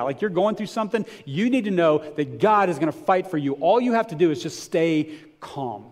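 ordinary talk

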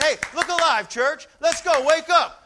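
loud, high wake-up call to the church; preacher shouting at the church to wake up; rousing shout. Real, not acted. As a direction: jolt the sleepy congregation awake. Preacher rouses the congregation.

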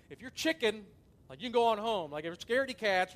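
loud, jeering taunt at cowards; mocking taunt. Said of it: "I start mo-, mocking them".

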